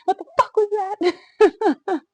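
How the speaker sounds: high, loud and slow, breaking into laughter; laughing disbelief. Retold or imitated. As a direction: shout it in shocked, laughing outrage. Retelling shock after birth.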